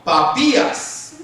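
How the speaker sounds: "Papías" with a long, stressed final í. Shown as a demonstration. Preacher trying out a hard name for the congregation, careful stress on the í of "Papías". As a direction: say "Papías" slowly, landing on pa-PÍ-as. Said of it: "porque el acento es en la I".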